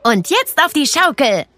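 put-on an excited little girl's shout, lightly done; mild child's shout. A narrator's reading. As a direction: Clara calling out on the playground. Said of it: "brüllte Clara".

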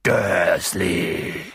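low, drawn-out boom of the name; deep, rumbling call. A narrator's reading. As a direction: boom it out like an angry giant. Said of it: "dröhnte er".

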